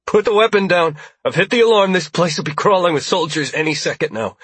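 high, loud, nervous warning; frightened guard raising his voice; trembling voice. A narrator's reading. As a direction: warn him off while frightened. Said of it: "voice trembling".

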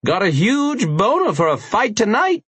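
put-on high, gleeful Cajun accent. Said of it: "in his strong Cajun accent".

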